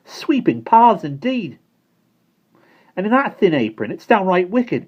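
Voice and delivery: be outraged on her behalf; indignant. Acted. Simon indignant.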